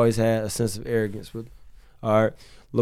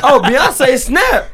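laughing; 13 semitones higher, 10 dB louder, much slower than usual. Real, not acted. Laughing exclamation, big laugh.

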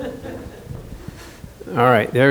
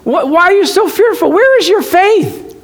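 high, incredulous rebuke; mock-scolding Jesus; 10 semitones higher than usual. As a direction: chide the fearful disciples. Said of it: "we're gonna enact this".

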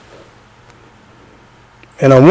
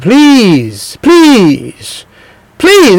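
[name] please; 6 semitones higher, much slower than usual. Retold or imitated. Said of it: "He said".

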